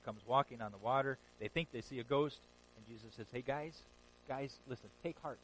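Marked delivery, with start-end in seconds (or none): 3.3-5.4 soft, low and calming; gentle reassurance. Retold or imitated. Jesus calming the frightened disciples on the boat, soft and gentle. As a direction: hush their fear gently.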